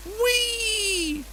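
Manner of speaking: high, held joyful squeal; gleeful whee. Real, not acted. Spraying water happily.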